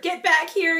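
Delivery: loud, high call; yelled. Acted. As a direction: call the crowd back. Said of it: "yelled Pip".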